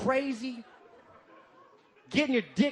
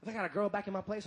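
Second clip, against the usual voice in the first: ordinary delivery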